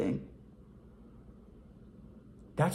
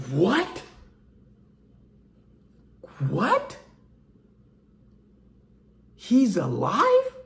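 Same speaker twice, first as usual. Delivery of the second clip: stunned, high rising disbelief; acted astonishment; 6 semitones higher, much slower than usual. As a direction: draw it out, pitch leaping up at the end. Acts out witnesses seeing Lazarus alive.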